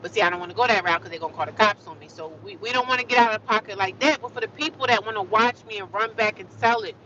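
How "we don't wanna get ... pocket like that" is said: ordinary talk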